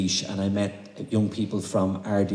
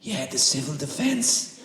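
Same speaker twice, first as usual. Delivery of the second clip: put-on child's voice, slow; 5 semitones higher than usual. Child voice on phone.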